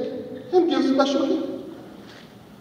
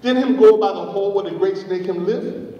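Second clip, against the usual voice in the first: usual speaking voice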